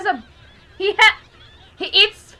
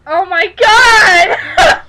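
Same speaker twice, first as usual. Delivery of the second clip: slow, loud cry of disbelief; shocked shout; 6 semitones higher, 10 dB louder, much slower than usual. Real, not acted. Shocked outburst at the show.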